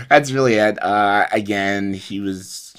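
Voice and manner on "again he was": ordinary delivery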